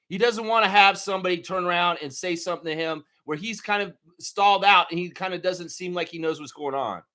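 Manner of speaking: ordinary talk, nothing marked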